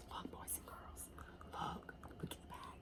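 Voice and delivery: put-on breathy urgent whisper to the kids. Teacher hushing the children to look back, whispered. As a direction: whisper it like a secret.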